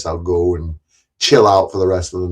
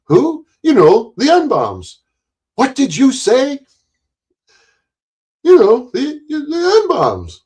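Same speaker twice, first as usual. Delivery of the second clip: much higher, animated character exchange; acted movie dialogue; 13 semitones higher, 4 dB louder, slower than usual. Streamer replaying The Jerk scene, high acted voices. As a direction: trade the quoted lines in a high cartoonish voice.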